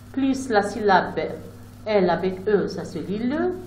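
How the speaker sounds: ordinary talk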